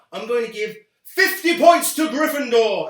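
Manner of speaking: loud, grand house-points proclamation; mock Dumbledore announcement. Parodying a headmaster to the viewers, loud and grand. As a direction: boom it like a headmaster awarding points.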